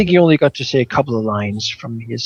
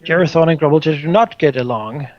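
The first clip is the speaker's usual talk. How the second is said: usual speaking voice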